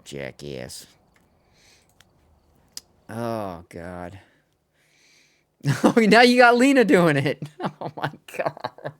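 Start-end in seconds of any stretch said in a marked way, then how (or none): 5.6-9.0 laughing. Real, not acted. Friends joking in chat.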